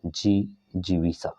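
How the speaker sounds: Jijivisha said slowly, each syllable drawn out; teacher stretching Jijivisha syllable by syllable; slow careful spelling. Shown as a demonstration. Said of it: "option number D आपका right हो जाएगा".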